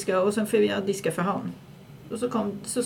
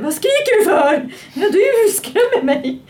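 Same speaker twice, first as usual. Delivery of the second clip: much higher and louder, slow, laughing through it; hysterical laughing; 13 semitones higher, 5 dB louder, slower than usual. Retold or imitated. Retelling the scare with David, laughing hysterically. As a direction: gasp it out laughing in panic. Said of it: "Så blev det hysteriskt".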